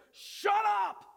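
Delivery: sharp loud shout; frustrated. Acted. Jesus frustrated at the raging storm, loud angry shout. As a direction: bark it at the storm. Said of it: "in his frustration".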